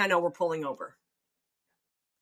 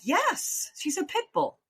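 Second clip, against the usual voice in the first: eager; 10 semitones higher, slower than usual. Retold or imitated. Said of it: "And I'm like".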